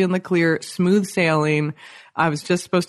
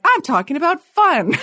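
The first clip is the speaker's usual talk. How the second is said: high, bright-eyed blurt, pitched well up and louder; excited shout; 13 semitones higher, 5 dB louder than usual. Retold or imitated. To the scientist at dinner, a high excited shout. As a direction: burst out with it almost comically.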